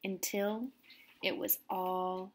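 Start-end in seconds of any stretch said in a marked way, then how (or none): none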